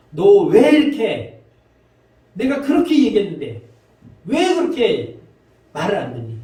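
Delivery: exasperated scolding, high and loud; angry Jesus. Acted. Jesus to a people who will not listen, angry. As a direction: scold loudly, stretching the words in frustration. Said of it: "예수님은 화가 나는 거죠".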